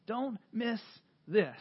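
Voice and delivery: normal talking voice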